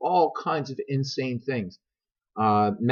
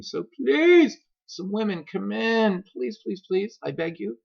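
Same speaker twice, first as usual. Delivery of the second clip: much higher, begging falsetto plea; high pleading beg; 11 semitones higher than usual. Pretend. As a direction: beg them to come in, high and desperate. Begging women to join stream.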